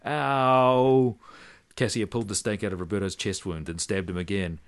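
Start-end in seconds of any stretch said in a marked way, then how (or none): 0.0-1.2 loud comic yelp; hammed-up ow; mock scream. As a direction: yelp as the stake goes in. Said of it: "he screamed".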